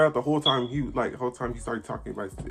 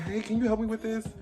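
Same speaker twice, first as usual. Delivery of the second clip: high-pitched imitation of a customer; lady asking help; 11 semitones higher than usual. Another lady needing help, high polite voice. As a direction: lift the voice high for a polite request.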